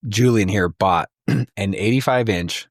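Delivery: usual speaking voice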